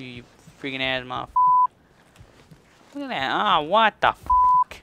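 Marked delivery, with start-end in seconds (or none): 2.9-4.8 very loud, high, spitting rage; furious scream. Real, not acted. Player screaming at a character that won't jump, furious. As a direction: yell it like the controller betrayed him. Raging at game.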